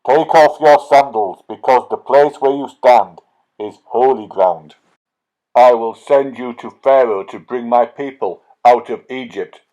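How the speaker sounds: loud, booming voice of God; God commanding Moses, loud and solemn. Acted. God commanding Moses from the holy ground, booming.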